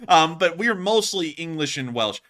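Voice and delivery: normal talking voice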